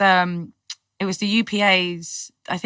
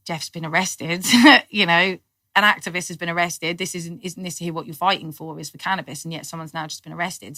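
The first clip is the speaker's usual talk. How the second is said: usual speaking voice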